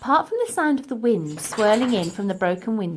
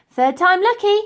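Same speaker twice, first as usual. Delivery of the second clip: plain reading voice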